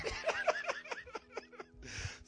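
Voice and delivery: high-pitched laughing fit; laughter. Real, not acted. Pastor cracking up at own joke, high laughter.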